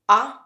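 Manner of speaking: a lone "a", cut short; short A sound. Shown as a demonstration. Teacher demonstrates the unstressed vowel, as a short "a". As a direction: say "a" as a brief, isolated vowel. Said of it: "also sounds like a short".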